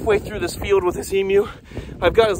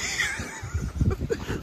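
laughing; 10 dB quieter than usual. Real, not acted. Startled laugh at emu.

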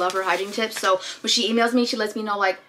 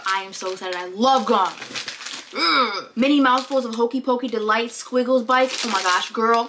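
ordinary talk, nothing marked

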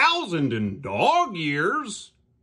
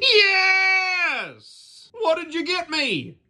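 much higher, louder eager cry; excited yell; 5 dB louder, much slower than usual. Acted. Birthday puppet shouting eagerly at his roommate. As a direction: shout it with greedy glee.

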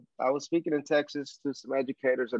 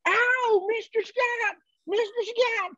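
very high, whooping Texan cowboy holler; thick Texan accent; slower than usual. Retold or imitated. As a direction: call out like a hammed-up Texas cowboy. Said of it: "and this thick accent".

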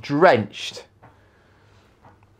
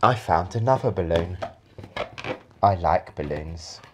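normal talking voice

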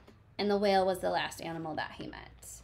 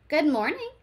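bright, higher cheerful greeting; friendly ladybug voice; 7 semitones higher, 5 dB louder than usual. Acted. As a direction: greet sweetly like a friendly bug.

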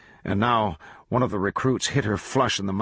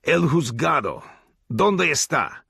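pressing demand; 7 semitones higher, 4 dB louder than usual. A narrator's reading. Glanton demanding in Spanish.

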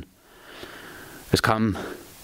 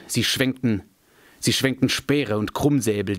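usual speaking voice

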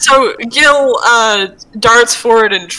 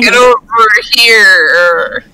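loud demand; 3 semitones lower, 7 dB louder than usual. Acted. Gil pulling his friend close, loud demand. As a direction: drag out "here" loudly.

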